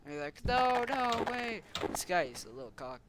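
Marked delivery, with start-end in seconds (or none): 0.4-1.6 high, louder play-acted protest; mock alarmed plea. Figures pleading with police who broke in, mock alarm. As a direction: stop them in pretend panic.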